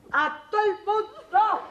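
wailing protest to Justino; anguished cry. Acted. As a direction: burst out in grievance. Outburst of grievance.

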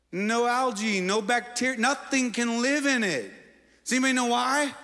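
high, insistent list building to a question; rising preaching. Acted. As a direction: hammer each "nothing can live" home. Preacher builds the Dead Sea point.

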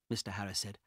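ordinary delivery, nothing marked

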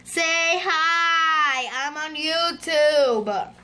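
long, singsong call for attention; drawn-out pleading call. Real, not acted. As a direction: stretch the words out like a chant. Calls out to other players.

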